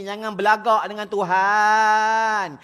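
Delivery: usual speaking voice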